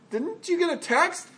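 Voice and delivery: can't believe you ignored that; incredulous question. Acted. Congregation confronting the host who ignored the warning, incredulous.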